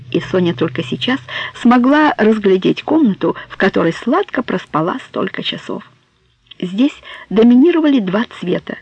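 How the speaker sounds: plain reading voice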